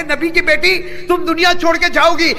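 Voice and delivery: husband bursting out to his dying wife; forceful outburst. Acted. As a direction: rise up in grief-stricken force. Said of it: "تھوڑا زور میں آتے کہتے".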